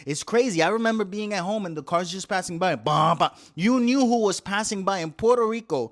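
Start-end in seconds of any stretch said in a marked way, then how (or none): 2.8-3.3 bass thumps. Retold or imitated. Imitating passing car bass.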